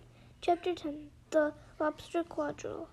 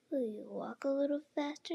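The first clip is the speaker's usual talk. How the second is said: ordinary delivery, nothing marked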